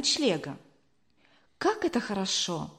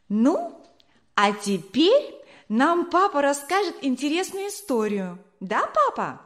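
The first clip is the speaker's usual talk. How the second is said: put-on high, eager child's voice; a little boy begging his papa for a story, eagerly; excited cry; 6 semitones higher than usual. Boy pleads with father at tea, high and eager. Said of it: "вскричал маленький шестилетний Эдуард".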